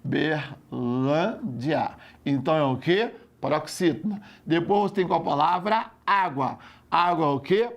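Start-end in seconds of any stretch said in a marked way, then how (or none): 0.0-1.9 "Uberlândia" drawn out very slowly, in separated syllables. Shown as a demonstration. Student sounds out the word, "Uberlândia" syllable by syllable. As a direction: split "Uberlândia" syllable by syllable, lower-pitched.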